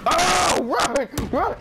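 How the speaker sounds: high yelled warning to run; urgent shout. Real, not acted. Gamer yelling at teammates mid-fight, urgent shout. Panicked in a firefight.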